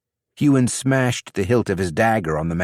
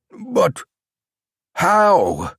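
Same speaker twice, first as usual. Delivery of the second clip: shocked disbelief; 11 semitones higher, 3 dB louder than usual. Acted. Champion shocked at broken oath.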